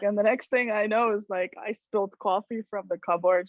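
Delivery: normal talking voice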